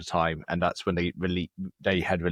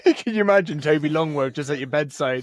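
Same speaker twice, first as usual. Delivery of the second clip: laughing; 10 semitones higher, 6 dB louder than usual. Real, not acted. Laughing at the bedtime idea.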